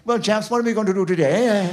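a hint of a posh public school accent; brightly posh, like a keen schoolboy; posh eager schoolboy. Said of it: "a public school accent then".

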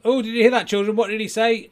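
high, loud entertainer asking the audience; ventriloquist act. Acted. Ventriloquist asks children what they heard, high loud patter. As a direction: exclaim to the kids in stage patter. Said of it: "here's my act".

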